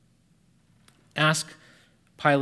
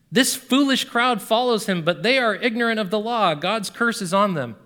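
higher, louder scornful dismissal; mocking; 7 semitones higher, 5 dB louder than usual. A narrator's reading. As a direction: look down on the crowd. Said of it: "the Pharisees mocked".

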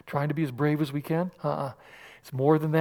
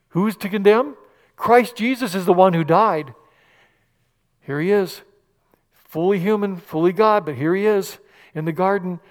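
ordinary delivery

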